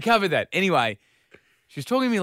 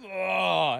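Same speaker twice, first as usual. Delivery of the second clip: higher-pitched, drawn-out groan of exasperation; exasperated colleague; 6 semitones higher, much slower than usual. Retold or imitated. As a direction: groan it out like a fed-up office worker. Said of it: "she overhears at his desk going".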